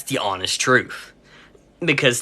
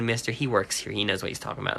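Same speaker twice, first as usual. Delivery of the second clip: retold quiet aside; 5 semitones lower, 10 dB quieter, faster than usual. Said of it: "that's when she said".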